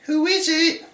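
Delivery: very high, sing-out granny falsetto. A narrator's reading. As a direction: call through the door as granny.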